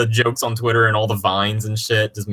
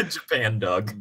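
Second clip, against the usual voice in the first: giggling through the words; laughing as you say it; 2 semitones lower, 7 dB quieter, slower than usual. Real, not acted. Laughing repeat.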